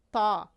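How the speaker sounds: heavy, rounded ṭ in "تا"; heavy emphatic T. Shown as a demonstration. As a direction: thicken the t of "تا" into a dark Arabic ṭā.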